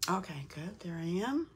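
normal talking voice

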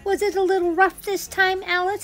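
very high, squeaky, cheerful rabbit voice; chirpy little rabbit greeting guests. Acted.

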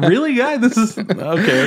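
high, exasperated mocking voice; an exasperated character asking if this is really happening; mock incredulous. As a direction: squeak it out in disbelief.

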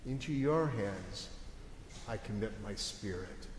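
soft, low, surrendering prayer; final prayer. Acted. Jesus prays his last to his Father, softly surrendering. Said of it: "That was Jesus' final prayers".

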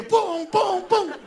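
loud, high repeated bangs; imitated thuds. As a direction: punch out each imitated bump.

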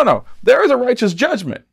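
usual speaking voice

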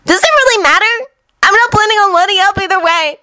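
put-on high cheerful boyish voice; Naruto voice. A narrator's reading. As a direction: grin through it. Naruto grinning.

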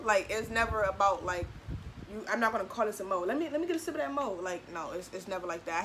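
ordinary talk

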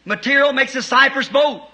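rising to a shouted climax; fiery preaching. Real, not acted. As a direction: hammer the point home from the pulpit. Preaching at full force.

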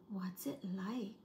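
hushed question. Acted. Panda asks about snow.

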